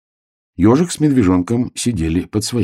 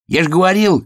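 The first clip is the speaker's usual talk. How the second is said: shout; 13 semitones higher, 3 dB louder than usual. A narrator's reading. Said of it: "крикнул медвежонок".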